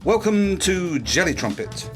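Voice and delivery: ordinary talk, nothing marked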